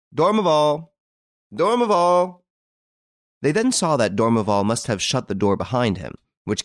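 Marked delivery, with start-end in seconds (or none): 0.1-2.4 high, louder repeated calling of a name. A narrator's reading. Card players call to d'Ormeval in the cabin, loud high calls. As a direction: shout the name toward the closed cabin. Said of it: "They called out".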